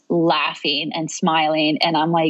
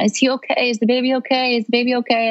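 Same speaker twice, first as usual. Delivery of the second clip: higher, urgent repeated questions; anxious asking; 7 semitones higher, faster than usual. Retold or imitated. New mother hearing baby cry, anxiously asking. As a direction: imitate a worried plea for reassurance.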